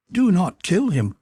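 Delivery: urgent cry. A narrator's reading. Captive girl begs the man not to shoot, crying out. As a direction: plead urgently to stop him. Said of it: "cried the girl".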